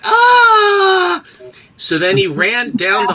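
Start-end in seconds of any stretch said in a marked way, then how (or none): 0.0-1.2 loud, high cry of horror; anguished yell. Retold or imitated. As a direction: re-enact a long, horrified scream. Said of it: "And I went".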